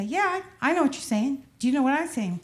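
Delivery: lightly voiced teen copying street talk; daughter's jive imitation. Daughter answering in mock jive, lightly voiced. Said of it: "my daughter would say".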